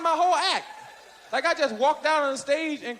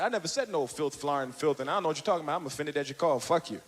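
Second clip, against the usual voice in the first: much lower, softer deadpan comeback; low offended retort; 12 semitones lower, 5 dB quieter, faster than usual. Retold or imitated. Offended comic answering Cosby's call, subdued retort. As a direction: answer back quietly, down low.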